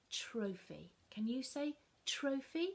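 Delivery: usual speaking voice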